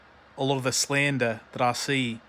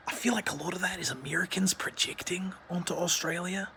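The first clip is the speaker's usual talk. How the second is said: whispered aside; 5 semitones higher, 4 dB quieter than usual. Acted. Conspiratorial aside about Americans.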